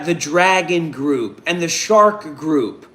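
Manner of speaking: usual speaking voice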